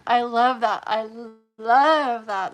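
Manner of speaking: loud and delighted; excited gushing. Real, not acted. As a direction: gush with real joy. Gushing over a viewer's comment.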